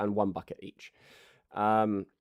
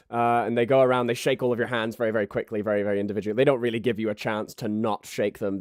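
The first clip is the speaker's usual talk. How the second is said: ordinary talk, nothing marked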